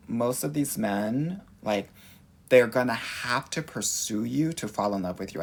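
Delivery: ordinary talk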